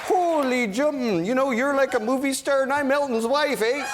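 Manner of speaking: put-on loud, high, thick Canadian accent; Dave Furnish. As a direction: stretch the opening in a broad Canadian caricature. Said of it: "He has a very thick Canadian accent".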